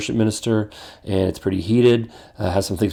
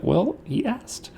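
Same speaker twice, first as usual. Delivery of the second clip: sheepish husband; 13 semitones higher, 7 dB quieter, much slower than usual. Retold or imitated. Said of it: "I'm like".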